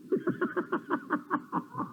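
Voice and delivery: soft unvoiced chuckle; quick breathy laugh, no voice. Real, not acted. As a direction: let out an airy laugh. Laugh after warning mothers.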